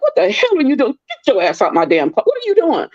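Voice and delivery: high, indignant telling-off; retold angry scolding. Scolding the carpet guy in her closet, indignant. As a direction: throw him out, outraged. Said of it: "I'm like".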